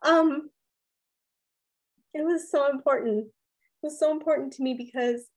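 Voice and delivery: real emotion catching up mid-sentence; getting emotional. Real, not acted. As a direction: voice lifting with real emotion, fighting it back. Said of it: "Sorry, I'm getting emotional here".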